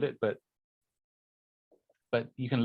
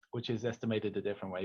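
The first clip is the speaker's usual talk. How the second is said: ordinary talk, nothing marked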